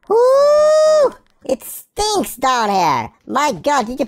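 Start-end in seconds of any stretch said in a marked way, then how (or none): 0.1-1.1 long, loud high-pitched woo; whoop. Real, not acted. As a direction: squeal a sustained woo at the stink. Reacting to the stink.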